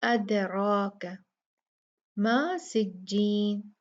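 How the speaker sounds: अदराक मा सिज्जीन slowly, with a heavy रा; measured tajweed reading; slow recitation. Shown as a demonstration. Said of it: "मुफख्खम पढ़ी जाएगी".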